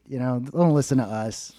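usual speaking voice

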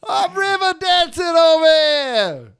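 high, slow East Coast scream; East Coast Irish-Italian. Acted. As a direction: stretch it into a huge East Coast bellow. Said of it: "we're not from the East Coast".

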